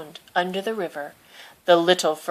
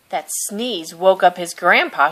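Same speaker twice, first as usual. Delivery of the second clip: usual speaking voice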